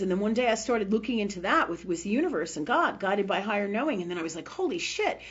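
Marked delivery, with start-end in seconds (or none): 4.5-5.2 high, amazed outburst; excited exclamation. Retold or imitated. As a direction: blurt it out in sudden discovery. Acts out own past realization.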